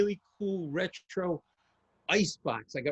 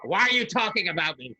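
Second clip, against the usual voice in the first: daughter's complaint; 3 semitones higher, 6 dB louder than usual. Retold or imitated. Daughter voiced.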